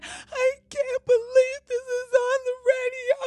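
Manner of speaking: high, whiny mock sob; hammed-up crying whine. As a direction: parody a woman wailing in tears. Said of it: "So she's just crying".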